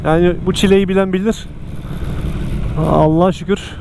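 ordinary delivery

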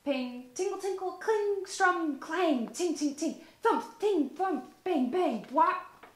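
high, loud sung-out instrument sounds; instrument noises. Acted. Olivia playing her one-girl band, chanting high and loud. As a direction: make each word a playful noise.

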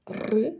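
"р" alone, rolled; hard consonant sound. Shown as a demonstration. Teacher repeats the sound for children, hard rolled "р". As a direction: roll a hard "р" by itself. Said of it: "твердий".

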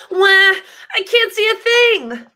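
high, loud wailing whine; mock whine. Lulu stuck in a traffic cone, high wail.

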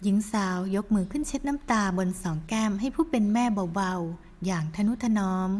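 ordinary delivery, nothing marked

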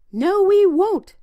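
high, loud, slow squeaky pigs; little pigs voice. A narrator's reading. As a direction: refuse it like stubborn piglets. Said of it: "said the pigs".

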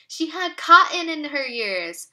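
plain reading voice

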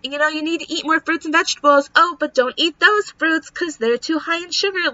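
high, mocking sing-song imitation of the doctors. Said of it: "being told".

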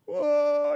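loud, high put-on bawl; hammed-up crying howl; mock wail. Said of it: "I cried some more".

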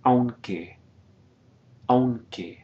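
aunque with au glided together; one syllable. Shown as a demonstration. Teacher models aunque, au in one syllable. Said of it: "We're combining those two vowels".

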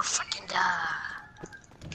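slow sarcastic whisper. Acted. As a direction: breathe it out slowly, deadpan. Sarcastic aside in game.

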